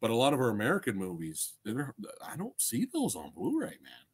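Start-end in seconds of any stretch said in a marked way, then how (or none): none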